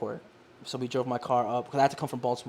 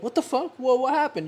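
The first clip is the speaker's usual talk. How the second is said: much higher, stunned outburst; shocked customer; 11 semitones higher, 5 dB louder than usual. Retold or imitated. Customer hit with a higher price, shocked and high. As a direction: squeal it in disbelief. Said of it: "he'd be like".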